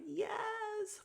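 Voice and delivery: high joyful squeal; excited yes. Real, not acted. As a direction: squeal it like your team just won. Relief that grandma stayed.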